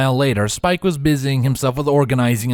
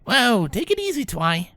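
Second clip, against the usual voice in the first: high placating defensive voice; 11 semitones higher than usual. Acted. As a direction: back off, hands up. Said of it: "Rainbow said defensively".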